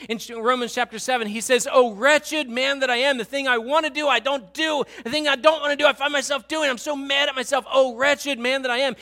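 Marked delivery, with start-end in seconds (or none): 1.7-9.0 anguished cry. Acted. Preacher voicing Paul's anguished cry.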